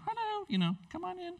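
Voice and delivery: high, soft sing-song welcome; a high, breathy hostess voice; mock host greeting. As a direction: greet the guest in a high, sweet voice.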